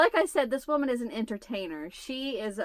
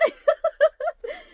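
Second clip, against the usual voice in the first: high laugh; 7 dB louder than usual. Real, not acted. Laughing at the memory.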